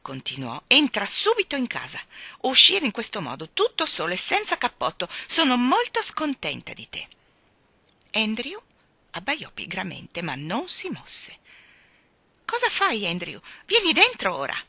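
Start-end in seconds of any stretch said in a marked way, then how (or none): 0.7-7.1 scolding. A narrator's reading. Owner scolds her runaway dog. 12.4-14.6 scolding. A narrator's reading. Owner orders her dog inside.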